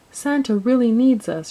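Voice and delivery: put-on rallying call from a storybook girl; announcing. A narrator's reading. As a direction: proclaim it urgently to a crowd. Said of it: "she announced".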